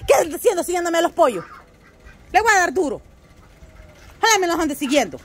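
high, loud scolding; scolding the dog. Real, not acted. Owner scolding her dog for chasing the chickens, loud high scolding.

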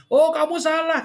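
high, loud, scolding; blunt staff. Retold or imitated. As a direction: snap at the customer that they're wrong. Staff bluntly telling customer off.

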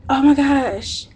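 ordinary talk, nothing marked

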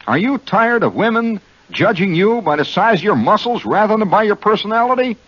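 high-pitched, emphatic column read-out; mock pitch reading. Host reads student column to the men listening, high and emphatic. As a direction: read it as a rousing appeal to men.

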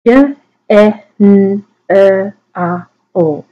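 "J, E, N, E, R, O" as speech sounds; sounding out. Shown as a demonstration. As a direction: phonemes of general, each isolated. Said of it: "we're gonna go through and sound them out".

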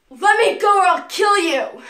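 loud, high furious threat; furious scream. Acted.

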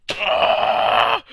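loud frustrated grunt; a real rage grunt, loud and high; angry groan. Real, not acted. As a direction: blast out a furious groan. Character just died.

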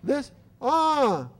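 put-on high, loud babyish "Ah, this"; baby reaching. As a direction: squeal it like a baby grabbing for a toy. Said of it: "And the baby goes".

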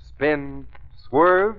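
ordinary talk, nothing marked

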